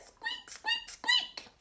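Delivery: tiny, very high squeaks; squeaking mouse. Acted. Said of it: "when the mouse tried to speak, she said".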